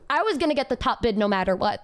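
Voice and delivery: ordinary talk, nothing marked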